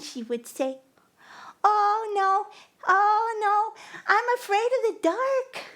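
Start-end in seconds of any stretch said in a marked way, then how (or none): 1.7-5.6 put-on high, sing-song wail of a frightened girl; lightly mock her whimpering in the dark; imagined scared crying. Narrator imagining the girl scared in the dark, mock wailing. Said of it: "she would start crying, this time for real".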